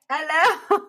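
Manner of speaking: high, exaggerated British hello; hammed-up British greeting, pitched right up; British accent. Shown as a demonstration. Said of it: "British accent".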